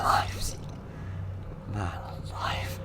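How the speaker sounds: nervous breathy whisper. Real, not acted. Gamer whispering while sneaking past noises. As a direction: hush it as if not to be heard.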